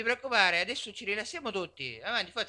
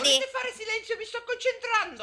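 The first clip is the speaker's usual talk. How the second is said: much higher, strained indignant snap; 13 semitones higher than usual. Acted. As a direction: shriek for silence, offended. Teacher snaps for silence.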